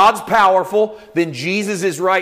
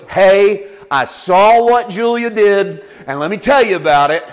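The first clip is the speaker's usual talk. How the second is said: eager tattletale; 4 semitones higher, 8 dB louder than usual. Acted. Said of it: "he runs straight to God and he goes".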